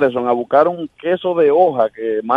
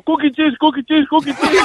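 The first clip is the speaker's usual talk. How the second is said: nervous answer; 11 semitones higher than usual. Retold or imitated. Panicked friend answering cop.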